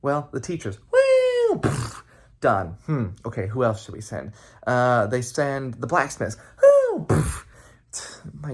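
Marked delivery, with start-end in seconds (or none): 0.9-1.6 high loud whoop of someone flung through the air; flying whoop. Acted. Trids booted off the mountain, high loud whoop. 6.6-7.1 high loud whoop of someone flung through the air; flying whoop. Acted. Trids booted off the mountain, high loud whoop.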